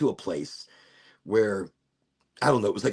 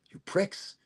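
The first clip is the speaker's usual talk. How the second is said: muttered insult; 7 semitones higher, 6 dB quieter than usual. Retold or imitated. Silently glaring at rude cops.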